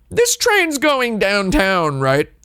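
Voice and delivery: put-on high, slow, drawn-out girl's question; mock tourist girl. Girl checking the train's direction, mock high tourist voice. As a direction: stretch the question out up high, imitating her. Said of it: "And then she goes".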